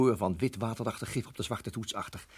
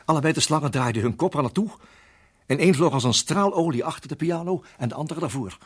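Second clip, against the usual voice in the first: louder and higher, racing; sudden alarm; 4 semitones higher, 9 dB louder than usual. Acted. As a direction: burst out as the snakes strike. Slangen schieten plots weg. (plots luid en opgewonden)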